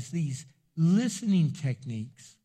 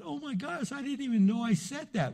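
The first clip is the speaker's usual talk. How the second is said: touched surprise; 7 semitones higher than usual. Retold or imitated. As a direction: imitate someone amazed at being understood. Acting listener surprised at being understood.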